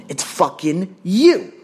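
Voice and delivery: slow, loud, high accusation; angry blame. Real, not acted. Trainer blaming the gym owners, angry and emphatic. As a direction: land each word hard and slowly.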